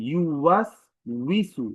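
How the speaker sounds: ইউ ওয়াস উইসু slow, with clear w glides; correct w sound. Shown as a demonstration. Teacher quotes Surah Nas as example, careful w. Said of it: "সঠিক উচ্চারণ হচ্ছে উই".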